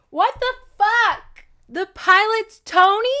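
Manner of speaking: loud, high incredulous outburst; shocked disbelief. Acted. Book recapper reeling at a plot twist, shocked disbelief. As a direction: draw it out, high and stunned.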